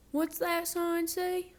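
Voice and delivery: high, slow, curious cow voice; lightly voiced curious cow. Cow Mabel asks.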